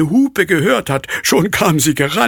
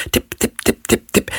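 footsteps. Acted. Hen's footsteps in the dark.

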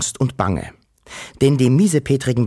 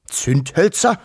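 puzzled rising question, higher pitched; grumbling father; 7 semitones higher, slower than usual. A narrator's reading. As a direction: query it as a mildly grumbling father. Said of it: "brummte der Wawuschel-Vater". (fragend brummelnder Vater)

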